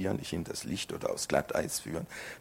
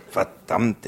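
1 startled curse; 5 semitones higher, 8 dB louder than usual. Acted. Sudden panic at Rita.

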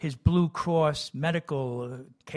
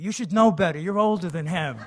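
louder, lightly voiced scolding mother; 4 semitones higher, 6 dB louder than usual. Mother scolding the older twin, lightly mimicked. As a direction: wag a finger at the older twin. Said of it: "my mother always used to tell me".

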